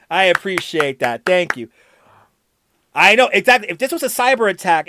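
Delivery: normal talking voice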